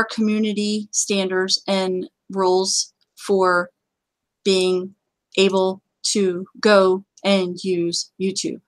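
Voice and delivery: slow, deliberate word by word. Real, not acted. Host spelling out YouTube's rules to viewers, very slowly. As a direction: space the words out with pauses. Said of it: "I'm gonna say that very slowly".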